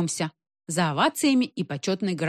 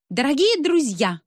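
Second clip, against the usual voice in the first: high, clear public address; ringing voice; 7 semitones higher than usual. A narrator's reading. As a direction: greet the audience in a ringing voice. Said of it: "сказала она звонко".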